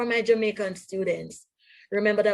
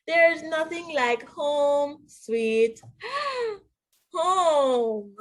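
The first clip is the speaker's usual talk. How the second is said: sentimental gasp; 6 semitones higher, much slower than usual. Acted. Mister Pig ends story sentimentally.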